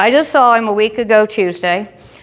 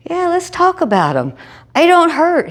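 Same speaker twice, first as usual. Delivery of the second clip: high mocking echo; 10 semitones higher than usual. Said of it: "I'm like".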